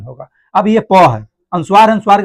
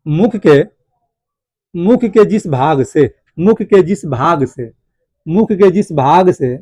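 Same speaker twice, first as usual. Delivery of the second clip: slow, drawn-out dictation pace; slower than usual. Real, not acted. Teacher dictating notes to students, slow and measured. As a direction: dictate it slowly, phrase by phrase.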